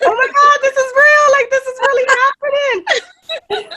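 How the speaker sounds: high, loud, half-laughing shriek of disbelief; screaming with excitement. Retold or imitated. Fan screaming at finding the singer's post on Instagram, excited shriek. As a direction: shout it out like you just can't believe it's real. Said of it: "screaming from the top of my lungs".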